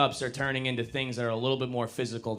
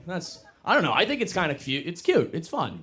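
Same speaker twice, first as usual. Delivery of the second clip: ordinary talk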